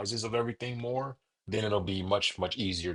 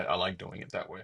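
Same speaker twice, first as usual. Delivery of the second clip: ordinary talk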